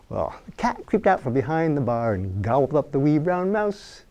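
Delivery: ordinary delivery, nothing marked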